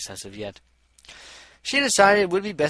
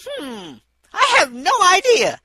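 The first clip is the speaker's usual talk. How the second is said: high, loud sing-song innocence; dodging the question in a squeaky voice; playful evasion; 10 dB louder, slower than usual. A narrator's reading. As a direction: play dumb with a bright squeaky lilt.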